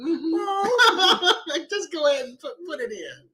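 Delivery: high, laughing through words. Real, not acted. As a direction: giggle through it.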